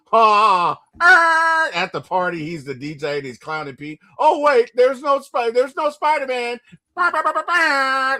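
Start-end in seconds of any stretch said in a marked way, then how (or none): 0.1-1.7 high, loud jeering ha; mock bully cackle at Peter; mocking laugh. Flash jeering at Peter at the party, loud mocking laugh. 4.2-8.2 high, loud taunting sing-song; hammed-up jerk taunting over a mic; mocking bully. Flash clowning Pete as party DJ, high loud taunt.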